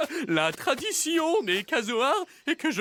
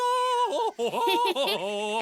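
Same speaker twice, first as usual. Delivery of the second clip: high laugh; 11 semitones higher than usual. Acted. Bird character laughs after line.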